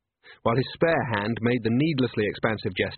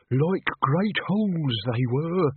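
put-on ominous slow old man; 5 semitones higher, slower than usual. Old man resumes tale dramatically.

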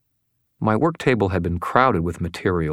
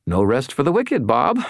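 higher, bright teasing cheer; cheerful; 7 semitones higher, 3 dB louder than usual. A narrator's reading. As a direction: chirp it like a pleased boss. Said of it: "I said cheerfully".

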